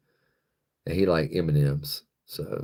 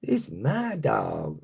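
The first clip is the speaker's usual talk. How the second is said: slow, deliberate mock ex-wife voice; a hint of a clingy ex-wife, slowly insisting; possessive ex-wife; 3 semitones higher, slower than usual. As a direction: drag each word out like a stubborn ex. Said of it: "She was like".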